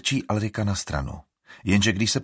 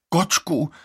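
indignant; 9 semitones higher, 5 dB louder than usual. A narrator's reading. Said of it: "rozčilí se Vigo".

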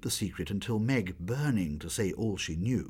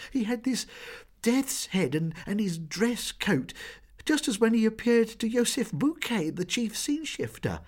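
fearful description; 13 semitones higher, 4 dB louder than usual. A narrator's reading. Girls describe the ghost in fear.

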